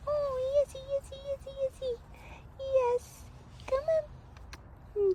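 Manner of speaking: high, soft cooing to cats. Real, not acted. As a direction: sing it up high like to babies. High pet voice greeting.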